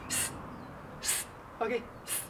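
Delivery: long hissing s, like a snake; drawn-out s like a snake. Shown as a demonstration. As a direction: hiss the s out like a snake. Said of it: "We pronounce s sound like a snake".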